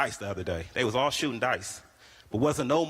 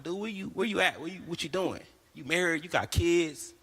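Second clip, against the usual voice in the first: higher rapid incredulous questions; incredulous grilling; 6 semitones higher than usual. Retold or imitated. Comic grilling his flirting cousin, incredulous. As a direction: grill him in disbelief.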